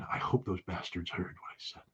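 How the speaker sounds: hushed aside under the breath; imitated whisper. Imitating the Russian ambassador at the concert, whispering. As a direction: mutter it like a worried diplomat hoping he was heard. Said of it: "Russian ambassador at a cultural event going".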